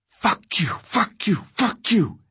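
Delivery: high raw screaming. A narrator's reading. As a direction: scream it over and over. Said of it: "he was screaming".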